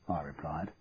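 ordinary delivery

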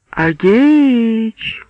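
high, drawn-out halloo; thin female call. A narrator's reading. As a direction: call as if lost in the woods. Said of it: "с каким кричат «Ау!»". (тонкий женский голос, как «ау»)

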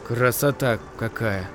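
low, soft trembling voice; shaky voice. A narrator's reading. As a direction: let the voice tremble. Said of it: "Голос его слегка дрожал".

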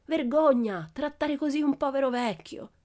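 high, indignant protest; brave rebuke. A narrator's reading. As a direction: speak up boldly, pitched well up. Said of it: "così coraggioso da dire". (protesta coraggiosa e indignata)

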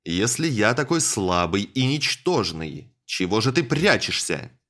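loud, challenging yell at a hidden foe; defiant shout. Acted. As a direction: call out angrily into the dark. Hero yells at hidden enemy.

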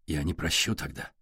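soft, low, understated choked delivery. A narrator's reading. Ярослав warns Сашка he would not forgive, mildly choked. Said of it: "чуть не задохнувшись".

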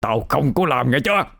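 tense threat through gritted teeth; tight, angry warning without shouting; growling through teeth. A narrator's reading. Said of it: "nghiến răng mà gằn giọng".

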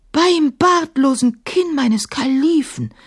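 high, slow astonished oath; exclaiming. A narrator's reading. Genie exclaims in wonder at the dog, slow. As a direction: marvel aloud at the sight. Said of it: "rief er aus".

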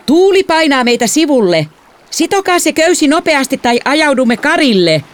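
urgent high-pitched shout; father shouting. A narrator's reading. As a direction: shout a warning over the wind. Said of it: "isä huusi".

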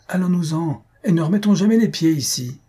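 high indignant exclamation, only mildly loud; wife's cry. A narrator's reading. Wife leaving the salon with husband, crying out. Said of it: "cria sa longue épouse".

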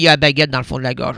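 low, fast mock Parisian voice; French accent. Mocking the French accent while joking with a friend. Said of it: "Le français, lui".

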